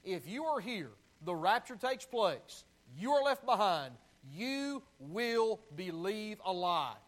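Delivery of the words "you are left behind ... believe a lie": ordinary delivery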